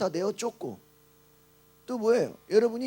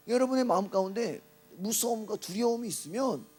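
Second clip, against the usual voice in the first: normal talking voice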